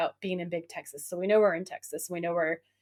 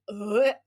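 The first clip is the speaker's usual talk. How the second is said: a short, louder groan of disgust; disgusted ugh; 4 dB louder than usual. Retold or imitated. Girl recoiling from the creepy guy, disgusted ugh. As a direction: grunt it out like she's grossed out. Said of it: "And she's like".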